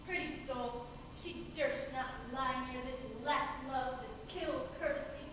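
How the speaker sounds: soft, hushed voice over sleepers; hushed spell. Acted. Puck sneaks over sleeping lovers.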